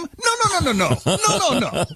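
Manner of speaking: high, rapid-fire string of refusals; mock protest. Acted protest to the AI.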